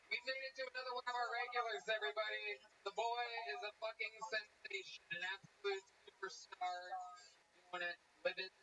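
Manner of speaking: big, projected stage introduction; ringmaster-style intro, starting high; show announcer. Acted. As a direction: hype up the next act for the crowd. Host introduces the next act.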